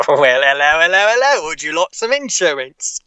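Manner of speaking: lightly Cockney insurance salesman, rising hellos; Cockney gecko. Pretend. Friend asks about the gecko's voice, so a Cockney salesman pitch. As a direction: sell insurance like a chirpy Cockney lizard. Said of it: "a ploy to get you to do voices".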